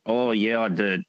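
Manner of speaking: staying deep in the ogre voice; lingering low ogre rumble; still in Shrek voice. Retold or imitated. Keeps Shrek voice after impression.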